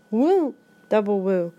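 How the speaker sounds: usual speaking voice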